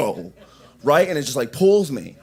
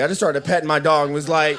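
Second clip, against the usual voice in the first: ordinary delivery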